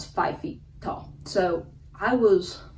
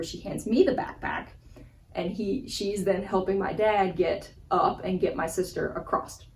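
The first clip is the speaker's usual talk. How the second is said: ordinary talk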